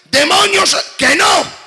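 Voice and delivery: very high loud yell; frantic shout. Acted. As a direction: scream it in desperation. Shouted exchange with demon.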